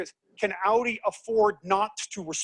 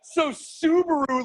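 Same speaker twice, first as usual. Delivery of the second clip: much higher, slow drawn-out Subaru; surprise reveal; 9 semitones higher, slower than usual. Real, not acted. Teacher revealing Subaru to class, amused high reveal. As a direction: savor the twist.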